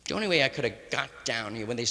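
usual speaking voice

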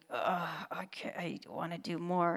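hushed, chafing frustration; frustrated whisper. Retold or imitated. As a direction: breathe it out, restless. Acted inner frustration.

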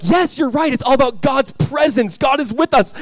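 high, loud forced agreement; hammed-up parent salvaging the answer; overeager spin. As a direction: seize on it with exaggerated enthusiasm. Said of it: "trying to, like, spin it".

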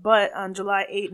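ordinary talk, nothing marked

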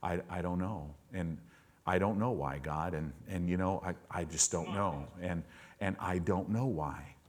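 soft, low, stammering I don't know; a cowed man mumbling his answer; timid stammer. Retold or imitated. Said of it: "And over and over for chapters".

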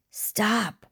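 high, breath-filled call. A narrator's reading. To the attacker dragging his friend away, calling out. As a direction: call out in a hushed, urgent cry. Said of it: "he called out".

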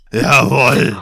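loud troll "Jawohl"; put-on troll voice, punched out; emphatic troll assent; troll's reply. A narrator's reading. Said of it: "sagte der Troll".

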